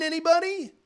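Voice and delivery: high teasing anybody?; rhetorical anybody. Real, not acted. Vlogger asking viewers about the TV slot, teasing. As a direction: toss it out to the crowd.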